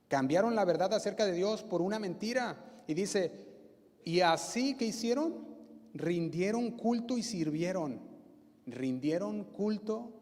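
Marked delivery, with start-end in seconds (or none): 0.1-8.0 louder, rising, emphatic preacher; fervent sermon voice; preaching at full force. Acted. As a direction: drive it home to the congregation.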